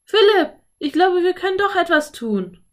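put-on high, loud girl's exclamation; sudden excited call. A narrator's reading. Girl calls to her brother with an idea, excited. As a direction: burst out with an idea, pitched well up. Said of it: "rief Anne auf einmal".

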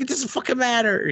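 high-pitched exasperated retort; mock exasperation. Retort to the uncle about comments, mock exasperated. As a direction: squeal it in mock frustration.

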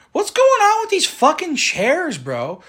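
high-pitched, drawn-out exasperation; exasperated rant. Real, not acted. Driver griping about Chicago streets, high and exasperated. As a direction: complain in high, slow disbelief.

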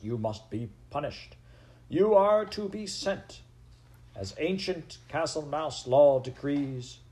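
loud, high outbursts between steadier decrees; stern angry judge. A narrator's reading. Head mouse passes angry sentence.

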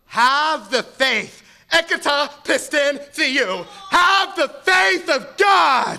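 slow, rising into a full shout; building preached shout. Acted. Preacher roars the verse at the congregation, building. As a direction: build from firm to roaring.

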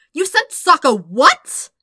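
loud, slow, incredulous shout; furious disbelieving shout. Acted. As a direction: yell it at him in disbelief. Shouting waterbender confronts Zuko.

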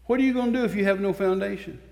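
ordinary talk

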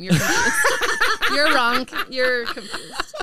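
loud laughing correction; laughing tease. Real, not acted. Host correcting co-host, laughing loudly. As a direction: laugh while teasing.

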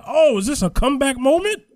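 high, loud re-enacted amazement; excited astonishment. Fan reliving the comeback moment for co-host, excitedly amazed. Acts out own reaction watching fight.

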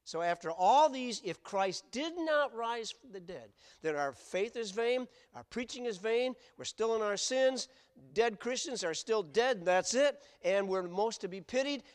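full-force pulpit preaching; fiery preaching. Acted. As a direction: hammer home how pitiful it would be. Preaching the hopeless alternative.

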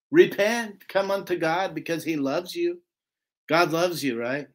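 normal talking voice

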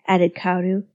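usual speaking voice

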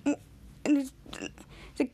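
normal talking voice

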